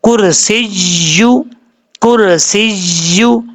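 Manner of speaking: slow कुर्सिय्यु with a held double य; tajweed drill; doubled ya. Shown as a demonstration. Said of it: "ये गलती समझने वाली है".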